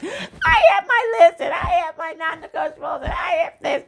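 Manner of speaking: high, mocking whine of a picky woman; mocking whiny woman. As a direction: mimic her list with a high sneer. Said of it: "I'm so tired of these women with these fucking".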